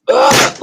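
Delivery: sudden high, loud imitated groan; pained groan. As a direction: mimic a pained grunt heard from the next room. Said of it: "noise like that".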